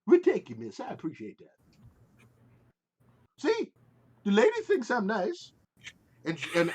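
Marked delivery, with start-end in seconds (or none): 0.1-1.6 pleased character voice. Acted. Simon thanks the player's character. 3.4-5.5 smug character voice. Acted. Simon gloats to Alma.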